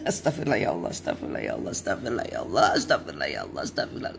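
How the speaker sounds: repeated softly under the breath, fading out; murmured istighfar. Shown as a demonstration. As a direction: murmur the prayer to yourself to calm down. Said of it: "ya, segera istighfar".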